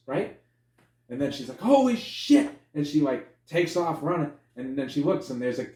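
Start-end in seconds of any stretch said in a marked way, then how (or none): 1.6-2.5 high, loud mock-horrified outburst; shocked yell. Officer seeing the missing fingers, high mock yell. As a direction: act out a horrified yelp.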